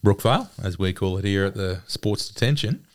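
ordinary delivery

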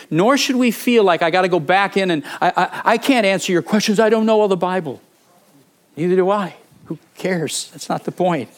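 sermon climax urging courage; fervent preaching. Acted. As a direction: rouse the congregation to be bold.

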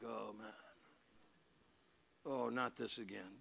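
soft, fed-up mock sigh; eye-rolling groan. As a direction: play the bored eye roller. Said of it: "You're like".

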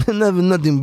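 ordinary talk

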